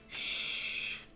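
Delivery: unvoiced hushing shh; a sharp whispered shh; whispered shush. A narrator's reading. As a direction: hush him sharply under the breath. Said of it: "replied Mike".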